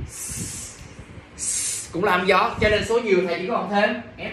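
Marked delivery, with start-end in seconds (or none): 0.1-0.8 "s" as a soft voiceless hiss; hissed s sound. Shown as a demonstration. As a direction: hiss "s" alone, no vowel. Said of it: "nó sẽ được phát âm là". (âm gió "s" không rung)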